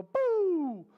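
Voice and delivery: loud, high, falling "boo"; exploding boo. Acted. Parenting teacher acts out a ticked-off parent, explosive boo. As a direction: blow your top in one burst. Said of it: "made you go".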